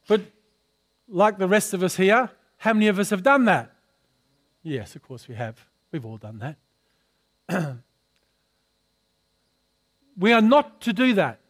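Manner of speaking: normal talking voice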